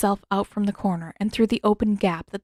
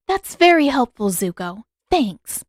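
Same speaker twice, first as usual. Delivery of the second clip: sarcastic; 4 semitones higher, 6 dB louder than usual. A narrator's reading. Katara sarcastic at useless answer.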